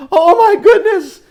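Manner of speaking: high, loud cry of amazement; amazed cry. Acted. Storyteller amazed at the whales, high cry. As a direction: shriek at the giant whales.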